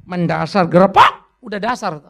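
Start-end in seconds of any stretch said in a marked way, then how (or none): none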